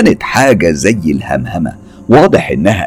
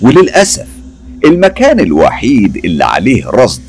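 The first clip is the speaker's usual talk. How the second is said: plain reading voice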